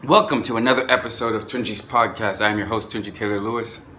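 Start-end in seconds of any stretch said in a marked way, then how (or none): none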